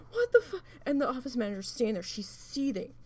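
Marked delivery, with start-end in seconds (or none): 0.1-0.6 high, loud startled yelp, cut short; startled outburst. Retold or imitated. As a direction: re-enact a jolt of shock. Startled by door slamming open.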